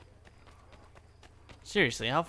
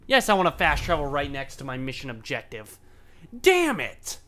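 exasperated outburst; 4 dB louder than usual. Real, not acted. Player finds fast travel beside his mission objective, exasperated outburst. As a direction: let real frustration burst out, ending in a yell.

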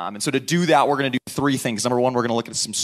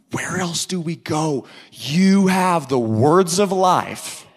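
slow, higher, drawn-out declaration; earnest acted plea; 4 semitones higher, 5 dB louder, much slower than usual. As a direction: answer as a devoted disciple, stretching each word. Acts out Peter answering Jesus.

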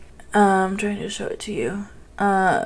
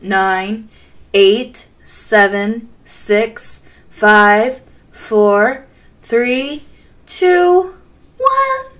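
building countdown; 5 dB louder, much slower than usual. Real, not acted. Gamer counting down to the trailer, building to a squeal. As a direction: count down getting more hyped each number.

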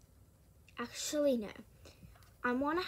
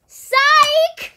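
very high, very loud gotcha yell; piercing mock-taunting shout; prank shout; 13 semitones higher, 20 dB louder than usual. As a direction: scream it out as a prank reveal. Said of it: "I said, "Psych!".

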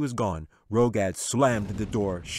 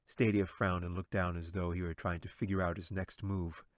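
ordinary delivery

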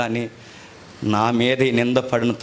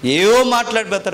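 scornful outburst; 10 semitones higher, 5 dB louder than usual. Acted. Scornful rant about critics.

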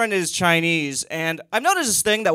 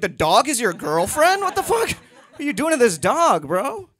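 higher, appalled stranger asking; shocked stranger; 8 semitones higher than usual. Retold or imitated. A stranger asks, shocked and high-pitched. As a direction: play a horrified passerby. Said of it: "they're like".

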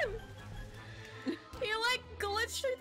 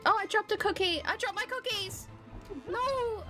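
high upset squeal; dismayed cry; 7 semitones higher than usual. Real, not acted. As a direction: cry out at dropping them. Dropping cookies in game.

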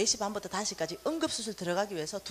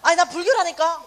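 higher, louder, fed-up insistence; exasperated grandma, much louder; exasperated retort; 6 semitones higher, 11 dB louder than usual. Retold or imitated. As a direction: tell her again you're Buddhist, losing patience. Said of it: "금방 잊어버리잖아".

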